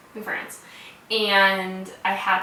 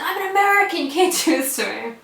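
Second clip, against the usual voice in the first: high, loud re-enacted outcry; screaming protest; 9 semitones higher, 4 dB louder, faster than usual. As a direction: re-enact a desperate screamed protest, easing at the end. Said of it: "I just kept screaming".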